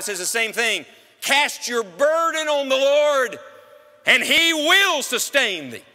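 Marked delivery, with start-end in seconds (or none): 1.2-5.8 loud, high, slow proclaimed scripture; preaching shout. Acted. Preacher quotes Psalm fifty-five to the congregation, slow loud shout. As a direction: proclaim the psalm slowly at full voice.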